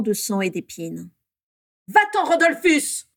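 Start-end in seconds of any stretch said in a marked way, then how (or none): 1.9-3.1 high, loud, slow shouted order; cri. A narrator's reading. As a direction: yell to drive someone away. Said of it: "cria Bellatrix en le bousculant". (ordre crié d'une voix aiguë)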